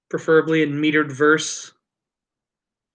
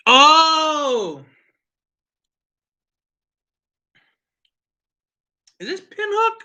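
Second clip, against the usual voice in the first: surprised; 12 semitones higher, much slower than usual. Real, not acted. Surprised recognizing the whiskey.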